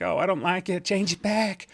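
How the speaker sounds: mock whiny viewer. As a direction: play a fussy viewer whining about the change. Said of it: "I bet you guys are like".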